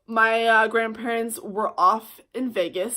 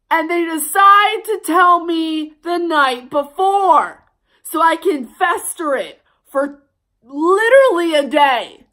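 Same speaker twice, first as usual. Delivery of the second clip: much louder, higher, slow outraged rant; real angry rant; 8 semitones higher, 10 dB louder, slower than usual. Real, not acted. Telling viewers about the trip, loud angry outburst. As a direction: vent it, every word punched. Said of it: "as much as I'm joking about it and getting angry".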